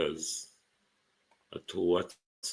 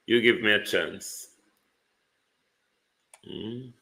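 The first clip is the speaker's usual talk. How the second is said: ordinary delivery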